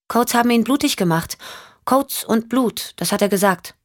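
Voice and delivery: put-on high-pitched little-girl voice; young girl. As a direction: insist in a high child's voice. (hohe, kindliche Mädchenstimme)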